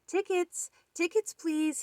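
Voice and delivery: high, ringing call for tickets. Acted. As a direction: call out to the crowd. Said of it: "he called".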